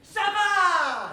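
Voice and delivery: loud, high call with a laugh; shouted Ça va. Shown as a demonstration. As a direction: egg the crowd on with a big shout. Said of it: "I'm gonna shout".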